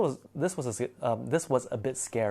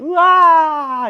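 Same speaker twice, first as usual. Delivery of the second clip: loud, high-pitched wail of panic; sudden high shriek, imitating frightened friends; freaked-out scream; 15 dB louder than usual. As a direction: scream out long like the whole group panicking. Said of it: "freaking out".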